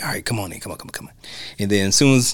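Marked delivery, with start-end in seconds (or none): 0.0-1.1 soft, nearly whispered invitation; quiet. Retold or imitated. Shoshanna luring the actor in, soft whisper. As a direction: coax him in under your breath. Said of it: "she kinda like".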